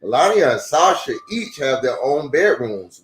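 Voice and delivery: usual speaking voice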